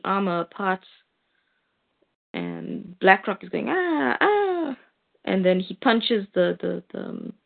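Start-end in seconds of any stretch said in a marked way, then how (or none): 3.7-4.8 mock pained cries. Villain being smashed.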